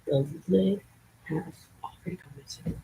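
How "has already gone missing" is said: the lid has already gone missing, dropped to a whisper; conspiratorial whisper. Shown as a demonstration. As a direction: repeat it as a hushed whisper.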